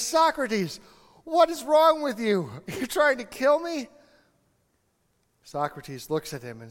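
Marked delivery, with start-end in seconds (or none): 0.0-3.9 high, loud, breathless protest; retold panicked cry, higher and louder; desperate outburst. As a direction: gasp it out after nearly drowning. Young man gasping after near drowning.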